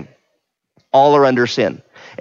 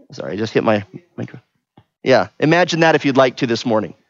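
ordinary delivery

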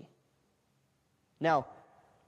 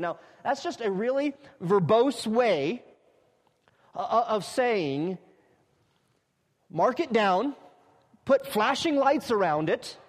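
higher, louder, driving the point home; fiery pulpit preaching, raised and loud; preaching at full force; 6 semitones higher, 6 dB louder than usual. Real, not acted. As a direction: preach it like the most important truth.

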